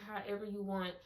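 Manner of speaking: usual speaking voice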